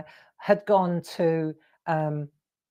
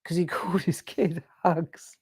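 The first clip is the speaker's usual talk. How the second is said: breathless whispered aside through laughter; laughing whisper; 5 dB quieter than usual. Real, not acted. Friend marvelling at the posh nickname, laughing whisper. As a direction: giggle it out under the breath.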